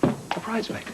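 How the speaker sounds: half-whispered, rising disbelief; a stunned gasp of a question; breathless surprise. Acted. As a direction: Brian hears Kristen wants Wendy as bridesmaid. Brian shocked by bridesmaid news.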